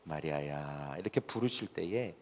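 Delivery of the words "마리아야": very low, hushed, tender call; Jesus. Acted. Preacher imitates Jesus calling Mary, tender, low and very soft. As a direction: imitate a loving voice, soft and deep. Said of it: "흉내를 낼 수는 없지만".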